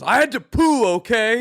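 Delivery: high, indignant mock-angry retort; mad outburst. Retelling the brother's answer when questioned, mock angry. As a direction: imitate a man angrily defending himself. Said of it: "got all mad".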